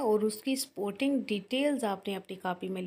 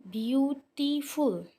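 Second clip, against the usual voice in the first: Beautiful higher, slowly split in three; syllable by syllable; 4 semitones higher, slower than usual. Shown as a demonstration. Teacher introducing the word, beautiful slow, syllable by syllable. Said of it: "इसके total तीन syllable बने हैं".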